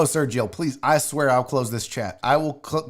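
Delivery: ordinary delivery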